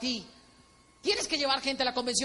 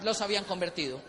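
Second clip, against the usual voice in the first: ordinary talk, nothing marked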